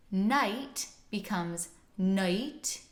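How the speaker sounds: slow, second "night" as "noight" with uh-ee vowel; price vowel shift. Shown as a demonstration. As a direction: start the vowel on a schwa. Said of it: "smush them together".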